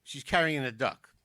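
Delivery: ordinary delivery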